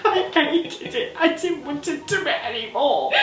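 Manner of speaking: high, squeaky talk through laughter; laughing helplessly. Real, not acted. Recalling the hated race, helpless high laughing. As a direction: squeak it out while cracking up.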